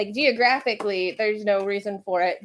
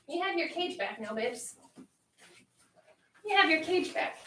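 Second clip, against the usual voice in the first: higher, lilting coaxing of a pet; pet talk; 5 semitones higher, 3 dB quieter than usual. Real, not acted. Owner coaxing a pet back to the cage, affectionate pet talk. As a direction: coo to a beloved animal. Talks fondly to her pets.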